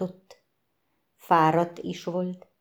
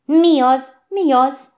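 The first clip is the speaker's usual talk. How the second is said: startled question; 7 semitones higher, 9 dB louder than usual. Acted. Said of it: "kérdezte Picur és Tücsök egyszerre".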